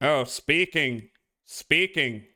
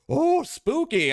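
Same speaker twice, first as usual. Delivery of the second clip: mock spooky; 13 semitones higher, 3 dB louder, slower than usual. Mocking ghost haunting.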